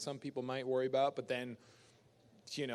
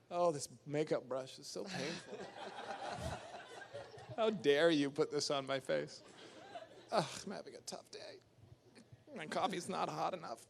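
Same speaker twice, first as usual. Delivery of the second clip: lightly voiced spoiled actor whining, laughing; a hint of a pampered star moaning; mock whiny actor; 3 semitones higher than usual. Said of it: "complain about this shit".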